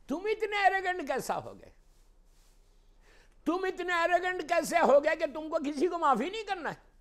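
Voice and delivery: high, shouted reproach; loud, indignant pulpit challenge; impassioned preaching. Real, not acted. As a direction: rebuke the congregation at full voice. Preaches at full force.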